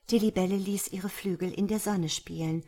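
plain reading voice

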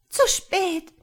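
high, loud cry of terror; horrified. A narrator's reading. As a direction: gasp it out in sheer fright. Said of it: "sagte es entsetzt". (entsetzt, hoch und laut ausgerufen)

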